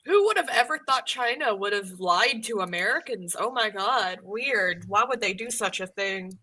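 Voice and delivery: mock-astonished sarcasm; sarcastic. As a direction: feign innocent surprise, dripping with sarcasm. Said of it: "hear the flash sarcasm in my voice".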